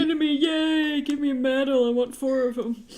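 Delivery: mock-proud old geezer demanding medals; old man gamer brag. Artist imitating the old man bragging about a kill. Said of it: "He does this old man voice".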